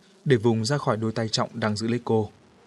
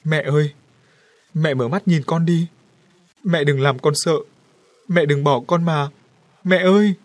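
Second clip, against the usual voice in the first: loud anguished crying out; heartbroken wailing; 5 semitones higher, 7 dB louder than usual. A narrator's reading. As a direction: wail for a dead mother, gut-wrenching. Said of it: "kêu gào xé ruột". (gào khóc xé ruột gọi mẹ)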